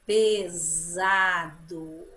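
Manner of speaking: "Pesado" drawn out slowly with a long buzzing Z. Shown as a demonstration. Teacher asking pupils to listen, Z in "Pesado". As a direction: stretch every syllable of "Pesado", voicing the S as Z. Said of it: "Som de Z".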